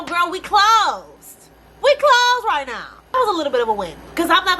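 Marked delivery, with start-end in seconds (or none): 0.0-3.0 high, slow closed-shop voice; mock staff voice. Vlogger imagining China Post staff telling her they're closed, mock drawl.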